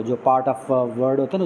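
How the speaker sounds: normal talking voice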